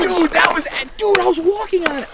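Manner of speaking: high, excited disbelief; stunned by the close call; amazed. Real, not acted. Ice cracks right after crossing.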